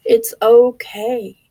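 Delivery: slow, emphatic comfort; reassuring. Real, not acted. Comforting viewers with depression, slow and firm. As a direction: draw it out to comfort. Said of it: "reassuring the viewer".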